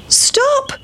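very high shouted stop. Acted. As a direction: cry out like excited boys. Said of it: "shouted the seven little boys".

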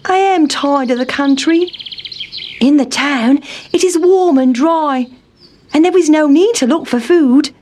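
a lightly voiced eager town mouse; Town Mouse pleading. A narrator's reading.

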